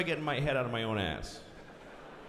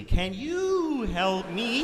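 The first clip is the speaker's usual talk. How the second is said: very slow, higher, rising probe; tuning his frequency; 6 semitones higher, much slower than usual. Retold or imitated. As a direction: stretch it out slowly, higher, as if tuning a radio. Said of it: "find his frequency".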